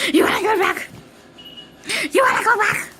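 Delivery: high, loud teasing call; high playful shout. Real, not acted. Repeating, louder each time.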